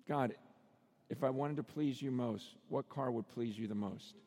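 low, soft, pausing appeal to God; voiced prayer, hushed and earnest; humble prayer. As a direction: ask God quietly and sincerely.

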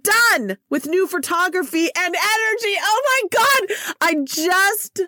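high, thrilled and bursting; giddy over the news; excited. Real, not acted. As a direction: squeal it out with joy. Excited about finished website.

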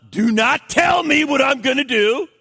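put-on high, loud rebellious child voice; a toddler defying his mother; defiant toddler. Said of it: "[name] demonstrates rebellion".

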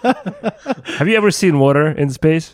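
laughing. Real, not acted. Teasing joke amid laughter.

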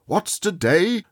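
put-on calling down to a boy below. A narrator's reading. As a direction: call down to the street. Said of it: "cried Scrooge".

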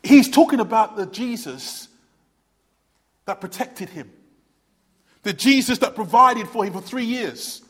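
normal talking voice

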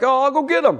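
eager volunteering. Acted.

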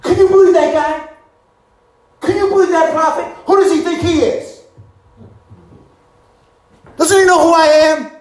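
high, loud indignant rant; hammed-up rage at his driver. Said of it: "He's ranting at his driver".

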